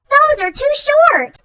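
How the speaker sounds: choosy monster scoffing in a high voice; picky squeal. Acted. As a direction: squeak out the complaint. Picky rejection of undies.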